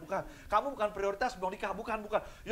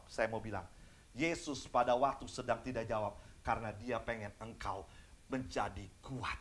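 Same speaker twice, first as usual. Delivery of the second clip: low, soft, intimate sermon voice; quietly earnest preacher; hushed preaching; 9 semitones lower, 10 dB quieter than usual. Real, not acted. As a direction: lean in and drop it down. Preacher drops voice for point.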